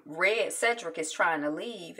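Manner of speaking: normal talking voice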